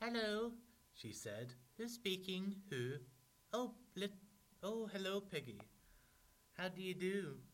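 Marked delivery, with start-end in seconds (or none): 0.0-0.5 high, loud sing-out telephone hello. A narrator's reading. 1.8-7.4 put-on high, loud storybook girl on the telephone. A narrator's reading.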